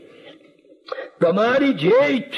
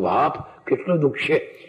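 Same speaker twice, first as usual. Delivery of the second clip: pitying sigh; 6 semitones lower, 3 dB quieter than usual. Acted. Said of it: "પાદરી જો કહું".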